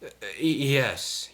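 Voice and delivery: flustered. A narrator's reading. Diesel is touched by praise, flustered stammer. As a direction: stumble over the word, caught off guard. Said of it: "he managed to say".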